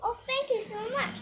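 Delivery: high-pitched, grateful thanks. Acted. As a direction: thank them in a high storybook voice.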